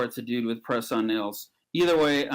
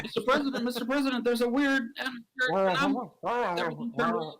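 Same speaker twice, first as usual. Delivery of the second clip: high-pitched, alarmed report; mock panic; 10 semitones higher, faster than usual. Man reports weird phenomenon to the President, mock panicked. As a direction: frantically get the President's attention.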